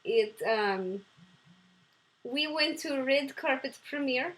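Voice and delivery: a bad Russian accent, only lightly put on. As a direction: play Mila, her silly Russian alter ego, with a lightly held Russian accent. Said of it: "[name], do your Russian impression".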